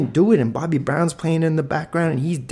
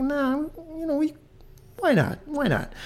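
much higher, breezy teacher voice; teacher agreeing; 5 dB quieter than usual. Retold or imitated. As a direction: shrug it off in a high teacher voice. Teacher agreeing to play song.

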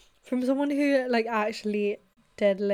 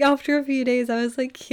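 ordinary delivery

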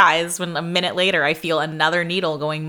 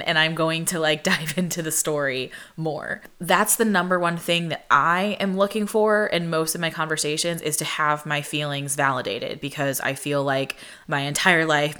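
ordinary talk, nothing marked

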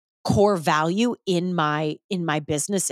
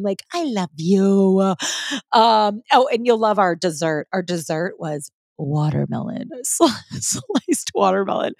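ordinary talk, nothing marked

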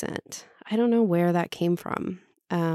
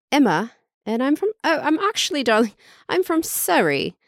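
put-on British Emma, higher pitch; British accent; 7 semitones higher than usual. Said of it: "I was doing a British accent".

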